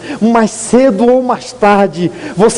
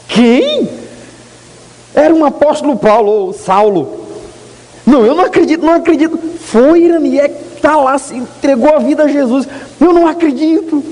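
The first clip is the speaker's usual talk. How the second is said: can't believe the news, pitched up high; disbelieving surprise; 8 semitones higher than usual. Retold or imitated. Sister's news on the phone, brother cries out in high disbelief.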